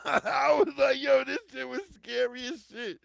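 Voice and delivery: laughing loud and high through it. Real, not acted. Telling listeners about the creepy plantation, laughing loudly. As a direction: laugh it out, half amazed half scared. Laughing recalling scary slave houses.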